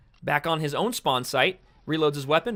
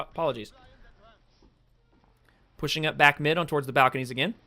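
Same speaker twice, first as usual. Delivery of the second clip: ordinary talk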